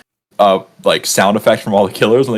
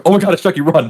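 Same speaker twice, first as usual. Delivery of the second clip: mock panic; 7 semitones higher, faster than usual. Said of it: "when I hear Brad Dourif".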